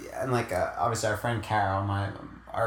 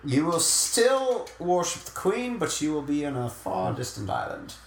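mock British decree; 4 semitones higher than usual. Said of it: "mock royal decree".

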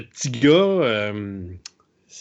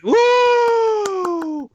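excited cheer; 3 dB louder than usual. Real, not acted. Cheering his own return.

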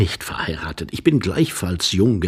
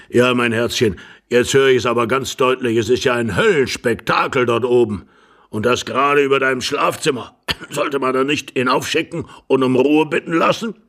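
put-on louder, fussy old man's voice; old husband; 4 semitones higher, 5 dB louder than usual. Old husband hears racket over the bedroom, complaining.

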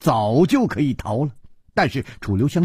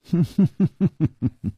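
mocking laugh; 4 semitones higher than usual. A narrator's reading. Nangong Ling laughs at Chu.

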